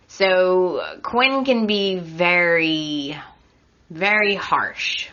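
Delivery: slow build, harsh dropped to a whisper; whispered emphasis. Real, not acted. Judging Quinn's treatment of Wyatt, slow then whispered. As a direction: lean in and breathe the word harsh.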